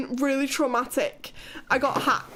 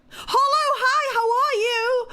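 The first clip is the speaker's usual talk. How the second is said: high excited greeting; 8 semitones higher, 5 dB louder than usual. Real, not acted. Greeting a newcomer.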